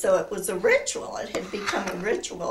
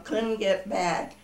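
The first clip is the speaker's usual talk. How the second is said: normal talking voice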